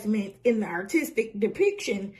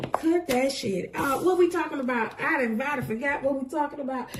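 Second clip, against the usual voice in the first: ordinary talk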